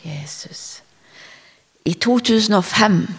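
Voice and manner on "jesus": hushed and reverent, barely voiced; whispered prayer. Real, not acted. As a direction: breathe it as a private prayer. Turns from preaching to prayer.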